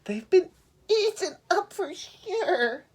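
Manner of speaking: high, slow, tearful sheep; worried sheep. Acted. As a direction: fret as a frightened little sheep. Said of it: "Sheep was getting worried".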